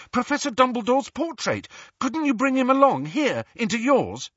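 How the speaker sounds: high, loud, eager urging; sudden inspiration. A narrator's reading. Harry urging the portrait to fetch Dumbledore, excited. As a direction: press the new idea excitedly. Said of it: "had a sudden inspiration".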